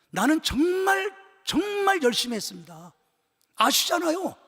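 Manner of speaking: high, loud pleading to God; pleading protest. Retold or imitated. Pastor protesting to God, high and loud. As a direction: argue your case with rising anguish. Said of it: "막 울면서 대들었어요".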